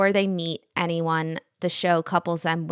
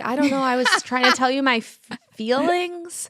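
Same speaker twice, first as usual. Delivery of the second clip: higher, louder imitation of someone floundering; mock flustered confession; 6 semitones higher, 5 dB louder than usual. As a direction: ham up an awkward confession of feelings. Mimicking evasive, confusing dating talk.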